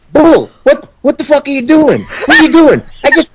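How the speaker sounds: high, panicked, laughing recruit voice; frightened recruit yelling at Bull; Jimmy impersonation. Retold or imitated. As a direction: play the terrified buddy, breathless. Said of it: "great Jimmy impersonation".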